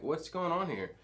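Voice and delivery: easy, lighthearted asking; breezy curious question; light, casual question. Shown as a demonstration. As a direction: ask it like you're just curious. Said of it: "It has a very light mood".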